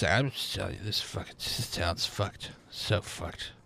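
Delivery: put-on low, quiet drunken grumble; lightly voiced drunk, trailing low and soft; drunk townsman. Drunk local grumbles about his town, low and quiet. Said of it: "who's drunk, he's just".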